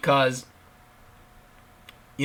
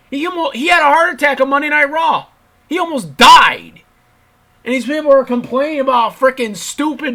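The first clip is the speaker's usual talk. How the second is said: much higher and louder, almost yelling it; exasperated outburst; 10 semitones higher, 7 dB louder than usual. Real, not acted. Vlogger to viewers, indignantly shouting about the heart attack. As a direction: hammer home that he nearly died. Ranting about the Lawler outrage.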